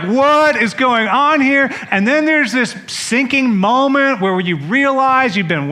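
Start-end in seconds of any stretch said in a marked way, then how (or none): 0.0-1.7 higher, louder, slow alarmed question; mock freak-out. Crowd seeing fire from heaven, hammed-up alarmed question. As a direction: spell out each word in stunned disbelief. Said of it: "we would all be freaking out".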